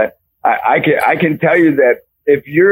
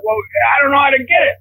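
flustered coach; 11 semitones higher, much faster than usual. Retold or imitated.

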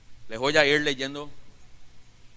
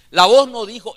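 preaching refrain; 6 semitones higher, 8 dB louder than usual. Acted.

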